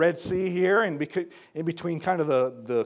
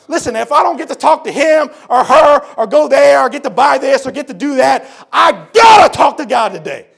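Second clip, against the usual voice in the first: loud, high, driving sermon voice; fiery preacher climax; preaching at full force; 8 semitones higher, 13 dB louder than usual. Acted. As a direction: preach it hard to the congregation.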